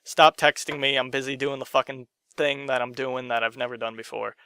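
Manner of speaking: ordinary talk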